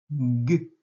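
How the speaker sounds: G as a bare voiced "guh"; isolated consonant sound. Shown as a demonstration. Teacher giving first sound of guidon, isolated G. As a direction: sound out G, not the letter's name. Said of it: "Le son".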